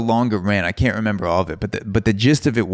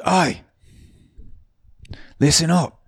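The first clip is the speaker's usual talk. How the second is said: put-on a terrible Australian accent, shouting to the room; 9 semitones higher than usual. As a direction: bartender hollering rules in a hammed-up Australian accent. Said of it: "bear with my Australian accent".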